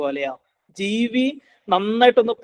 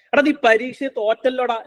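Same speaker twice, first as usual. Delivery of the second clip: higher-pitched bad-example scolding; mock parental reproach; 6 semitones higher than usual. Shown as a demonstration. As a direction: tell a child off, lightly acted. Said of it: "എന്ന് പറയുന്നതിന് പകരം".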